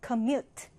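commute with the weight on MUTE, com light and short; stress on second syllable. Shown as a demonstration. Teacher reading a new word to students, stress on the second syllable. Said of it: "두 번째 음절에 강세를 두고요".

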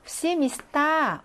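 시미스타 drawn out on the final 타; 시미스타, slow, with end stress. Shown as a demonstration. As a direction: slowly land on the last syllable of 시미스타. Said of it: "강세가 뒤로 이동을 해서".

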